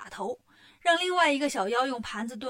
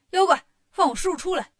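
higher, louder challenge; calling out a challenge; 4 semitones higher, 6 dB louder than usual. A narrator's reading. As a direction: call the monster out. Challenging the monster at the cave.

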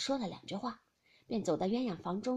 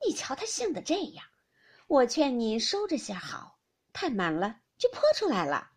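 higher, half-teasing advice; a light warning wrapped in a joke; Youshi's warning; 3 semitones higher, 5 dB louder than usual. Acted. Youshi cautioning Fengjie to hold back, lightly voiced.

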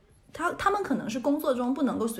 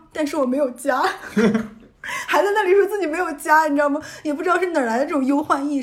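laughing; 5 semitones higher, 8 dB louder than usual. Real, not acted. Laughing at own story.

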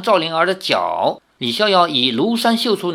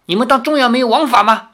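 fast, louder, higher indignant shout; angry retort; 7 semitones higher, 4 dB louder, faster than usual. A narrator's reading. Young hero angrily confronts the Miao chief, fast loud shout. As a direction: demand an answer, furious. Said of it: "怒道".

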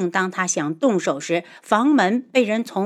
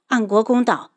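ordinary delivery

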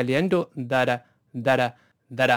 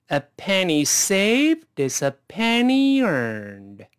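slow model reading; 4 semitones higher, much slower than usual. Shown as a demonstration. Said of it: "咱们一块儿来读一遍".

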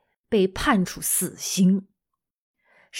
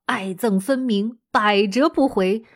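ordinary delivery

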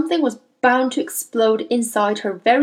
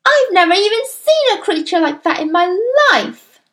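high, loud denial; outraged, loud innocence; screamed protest; 10 semitones higher, 6 dB louder than usual. A narrator's reading. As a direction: keep protesting at the top of your pitch. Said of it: "Matilda screamed".

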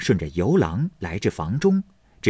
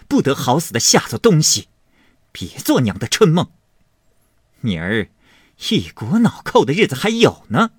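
sneering tirade; 3 semitones higher, 4 dB louder than usual. Acted. Mistress cursing those who complained about maids' pay, sneering angrily. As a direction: rail contemptuously, punching out the insults. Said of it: "又冷笑道".

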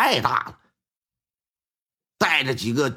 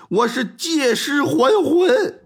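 said louder and slowly, higher, each word spelled out; loud slow repeat; 7 semitones higher, 4 dB louder, slower than usual. Acted. Son repeats to his father, who misheard: louder and slow. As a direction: make the deaf old man hear it.

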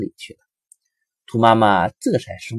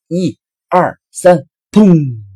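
counting shout; 8 semitones higher, 12 dB louder, much slower than usual. A narrator's reading. Bunnies slam door.

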